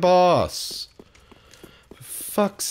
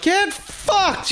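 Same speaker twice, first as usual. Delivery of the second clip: high-pitched sudden curse; angry outburst; 12 semitones higher than usual. Real, not acted. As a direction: spit the curse out in frustration. Cursing at game enemy.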